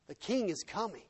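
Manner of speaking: ordinary delivery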